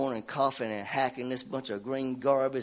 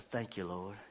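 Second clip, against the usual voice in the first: "Thank you, Lord" said much softer and lower, under the breath; quiet thanks; 6 semitones lower, 13 dB quieter than usual. Retold or imitated. Preacher recalling his private relief at the store, "Thank you, Lord" murmured low.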